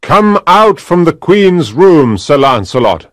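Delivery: loud demand through a closed door; knight's ringing challenge; loud cry. A narrator's reading. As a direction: call him out forcefully. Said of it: "he cried loudly".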